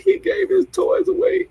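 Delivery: slow, very high squeaky wail; mock crying. Telling how she answered when asked what's wrong, high mock crying. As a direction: whimper it high, imitating your own blubbering. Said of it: "what I kept saying when I was crying".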